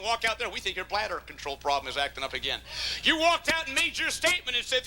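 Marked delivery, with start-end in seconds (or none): 0.5-2.6 soft, fast dry dig; sarcastic jab. Acted. Preacher mocking a man who walks out, dryly. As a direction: toss it off sarcastically. Mocking aside about walking out.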